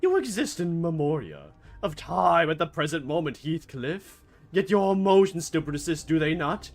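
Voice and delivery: grand dramatic recitation. Acted.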